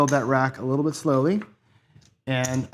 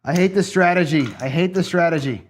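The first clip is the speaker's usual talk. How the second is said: louder, higher, fed-up repeat; real loud frustration; 4 semitones higher, 7 dB louder than usual. Real, not acted. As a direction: spit out your hatred of it. Losing again at craps.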